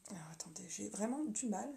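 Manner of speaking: aparté soufflé tout bas; chuchoté. Real, not acted. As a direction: murmurer pour soi en se débattant. Aside while fixing the camera. (chuchotement à voix basse)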